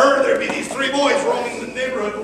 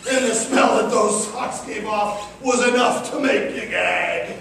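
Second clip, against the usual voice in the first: plain reading voice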